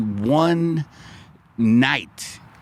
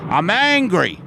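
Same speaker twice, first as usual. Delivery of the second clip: higher, declaring anger to the street; mock angry shout; 8 semitones higher than usual. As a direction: call it out like a mantra. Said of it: "being sober 'cause I'm very in touch with it".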